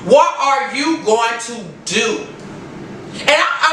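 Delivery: ordinary delivery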